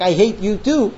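ordinary talk, nothing marked

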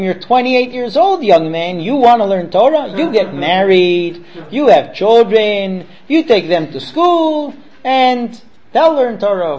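high, loud, drawn-out fatherly lecture; padre che fa la predica. Retold or imitated. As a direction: spell it out slowly and forcefully for a grown son. Father lectures his grown son.

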